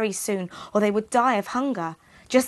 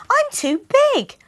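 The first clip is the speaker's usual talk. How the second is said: put-on very high, louder girlish plea; small girl pleading; 8 dB louder than usual. Gretel tricks the witch, high innocent plea.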